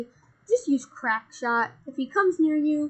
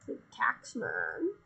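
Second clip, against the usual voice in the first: deep deadpan reply; 8 dB quieter, slower than usual. Acted. Deadpan reply in the repeating bit.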